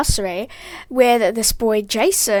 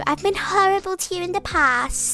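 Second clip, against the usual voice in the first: lightly voiced little girl, higher; Sunny; 4 semitones higher, 3 dB quieter than usual. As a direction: make sugary amends in a child's voice. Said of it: "Sunny was like".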